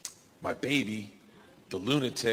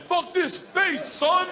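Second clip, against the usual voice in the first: much higher, hammed-up taunt; high hammed-up trash talk; high mock bro voice; 13 semitones higher, slower than usual. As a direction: shout it like a cocky baller. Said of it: "holding their own head".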